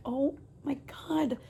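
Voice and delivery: hushed oh my God; exasperated whisper. Retold or imitated. Overwhelmed at the DMV, whispering. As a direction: whisper it, at your wit's end.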